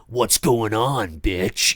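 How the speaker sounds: low, slow, sneering voice; a crude prank caller; hostile prank caller. Retold or imitated. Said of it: "he's not".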